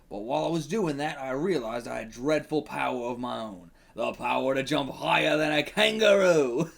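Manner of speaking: put-on Australian storyteller; hammy Australian accent. The Kangaroo narrating his outback backstory, hammy Australian accent. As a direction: tell it in a broad mock Australian accent. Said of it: "I gotta say crikey, get into it".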